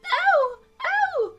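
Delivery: very high, loud shrieks; screams like a whistle. A narrator's reading. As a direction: shriek like a steam engine. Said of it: "Her screams were so exactly like the whistle".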